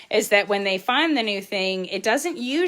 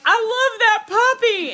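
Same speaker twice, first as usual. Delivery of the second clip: much higher, louder gushing mock voice; imitating the vet's husband; 6 dB louder than usual. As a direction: gush over the puppy in a high mock voice. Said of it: "he was like".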